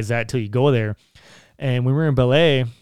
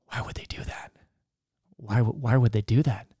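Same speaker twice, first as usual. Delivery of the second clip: hushed worried whisper to brother; 5 dB quieter than usual. Retold or imitated. Whispering to his brother, scared in Brazil, hushed. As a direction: breathe it under your breath, uneasy.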